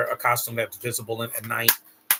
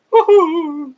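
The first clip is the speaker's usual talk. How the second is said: a much higher, much louder cheer; excited whoop; 13 dB louder than usual. Real, not acted. Vlogger cheers at the screen, loud high whoop. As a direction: whoop it out like a fan.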